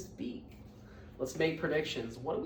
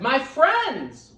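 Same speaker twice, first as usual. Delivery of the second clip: louder eager call; crying out; 4 semitones higher, 14 dB louder than usual. Acted. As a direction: call down hopefully to friends. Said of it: "cried the Horton".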